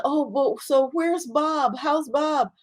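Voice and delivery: high, hopeful asking after her husband; forgetful patient asks her friend about her husband, high and hopeful; innocent eager question. Acted. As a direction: ask lightly, not knowing.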